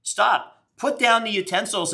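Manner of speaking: high, insistent call to stop; calling out insistently. Real, not acted. Telling Alice to stop cooking and watch, high insistent call. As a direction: cut in with a high-pitched plea.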